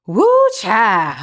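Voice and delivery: exaggerated "woo child" exclamation; exclaiming together. Shown as a demonstration. Host joining her energetic sister in a shared exclamation. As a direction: let it out with a big drawn "woo". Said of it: "let's say it together".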